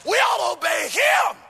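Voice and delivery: loud rhythmic whooped sermon over the organ; full-force preaching. Acted. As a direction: ride the rhythm, preach it out to the rafters.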